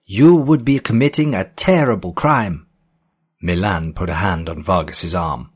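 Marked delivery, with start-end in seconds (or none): 0.1-2.7 high-pitched, loud, agitated accusation; shrill. A narrator's reading. Physicist accuses Joel of a terrible crime, shrill. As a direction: raise the voice shrilly in protest. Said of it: "his voice shrill".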